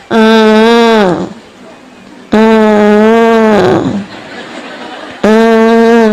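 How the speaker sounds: lightly imitated fussy child hums; whiny little hum, half-hearted; mock child whimper. Imitating a fussing child a busy mother ignores, mock whimper. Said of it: "ada anak nangis gini nih".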